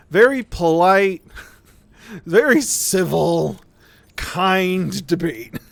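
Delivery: slow, sarcastic with laughter. Real, not acted. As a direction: drawl it out ironically, laughing. Ironic praise of bitter debate.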